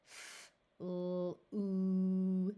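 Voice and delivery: F, l, oo stretched; sounded out slowly. Shown as a demonstration. Teacher blending flew for beginners, stretched. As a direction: hold each sound of F, l, oo. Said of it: "we sound it out slowly".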